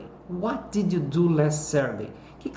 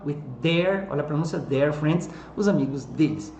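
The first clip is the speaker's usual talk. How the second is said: ordinary delivery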